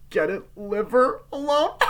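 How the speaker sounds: high, loud, drawn-out acted taunt; taunting warning. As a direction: stretch the words out to rub it in. Acting mocking climax of the warning.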